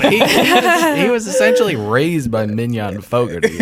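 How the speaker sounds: real laughter breaking into the words; laughing. Real, not acted. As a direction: tease through a high, loud fit of laughter. Cracking up while teasing co-host.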